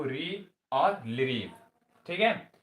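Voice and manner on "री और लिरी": very slow, every syllable spaced for writing; slow dictation. Shown as a demonstration. Teacher dictating to students, very slow. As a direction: dictate री और लिरी slowly. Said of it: "लिख लो".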